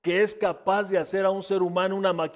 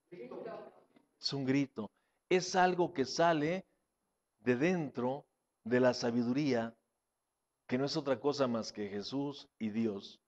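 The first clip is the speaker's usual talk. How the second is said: hushed preaching; 9 semitones lower, 7 dB quieter than usual. Acted. Drops to hushed intimate preaching.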